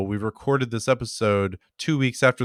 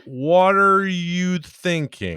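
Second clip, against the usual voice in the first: slow, drawled title reveal, pitched up; slow, higher announcement of a title; drawn-out title; 6 semitones higher, much slower than usual. Acted. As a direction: stretch the words out slowly and higher. Drawling the episode title for effect.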